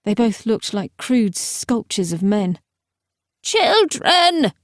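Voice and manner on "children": high-pitched call from a hatch; high cry. A narrator's reading. Teacher calls down to everyone from the hatch, high cry. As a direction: cry out high to those below. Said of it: "cried a voice high from the hatch".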